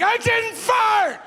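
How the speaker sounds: very high, slow, stretched shriek; hammed-up scream of protest; imitated screamed denial. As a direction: mimic a woman at her wits' end. Woman's last desperate denial.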